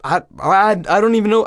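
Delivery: high, flustered protest; panicked stammer. Retold or imitated. Grabbed man answers angry boyfriend, panicked stammer. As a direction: stammer helplessly, voice jumping up.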